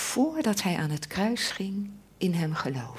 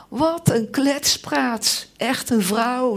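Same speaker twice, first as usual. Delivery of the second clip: mock dismissive scoff, higher and louder; scoffing disciples; 6 semitones higher, 6 dB louder, slower than usual. Preacher imitating disciples scoffing at Mary, mock dismissive. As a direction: wave it off as nonsense. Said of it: "die hadden gezegd".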